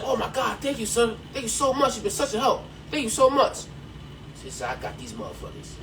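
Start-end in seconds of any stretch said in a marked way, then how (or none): 0.0-3.6 gushing thanks. Acted. Grateful guests thanking. 4.8-5.8 a soft, low aside of grim satisfaction; quiet gloating threat; low menacing aside. Acted. Farmer's private vow.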